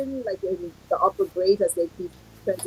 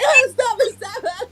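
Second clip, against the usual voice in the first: very high, laughing mock sob; imitating ugly crying; 3 dB louder than usual. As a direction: wail through a hammed-up cry. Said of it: "one little girl that did that".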